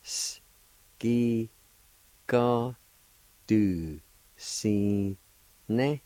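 isolated S, long separate syllables, last one lifted; syllable-by-syllable pronunciation. Shown as a demonstration. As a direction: sound out each piece slowly. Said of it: "it's pronounced like this".

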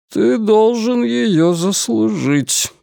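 slow, cold stern reply. Acted. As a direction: say it coldly and sternly. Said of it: "строго произнёс Деборд". (строго)

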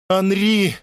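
very high cry of despair; high, desperate wail; anguished scream. A narrator's reading. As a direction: scream in horror at losing him. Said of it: "закричала она".

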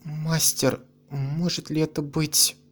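put-on quietly shaken old woman; trembling voice. A narrator's reading. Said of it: "Голос Мэрины дрожал".

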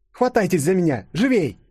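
high, forceful order; urgent command. A narrator's reading. Girl ordering friends to hold on before escape, urgent high command. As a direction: hurry them along sharply.

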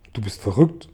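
plain reading voice

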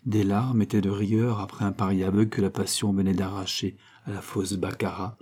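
plain reading voice

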